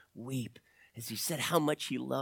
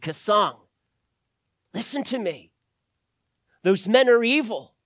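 raised, high, forceful appeal; urgent plea; 6 semitones higher, 7 dB louder than usual. A narrator's reading. Doctor appeals to young man holding gun, urgent. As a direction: press him hard to listen. Doctor pleads with gunman in story.

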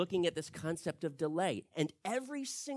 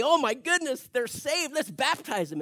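excited; 8 semitones higher, 7 dB louder than usual. Pretend. Imagined eager Peter.

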